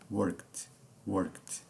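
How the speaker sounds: "worked" as one syllable, E dropped, ending in t; silent E in worked. Shown as a demonstration. English teacher showing students how worked is pronounced, silent E. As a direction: say "worked" with no vowel in the -ed. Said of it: "Perceba como eu pronunciei".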